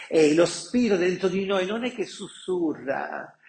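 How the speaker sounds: usual speaking voice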